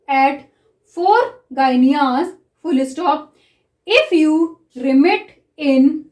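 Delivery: very slow, even dictation reading. Shown as a demonstration. Teacher reads a shorthand passage to students, very slowly. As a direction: read it out word by word for writing.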